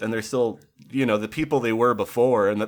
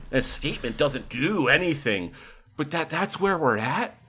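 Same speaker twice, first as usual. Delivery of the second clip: ordinary talk, nothing marked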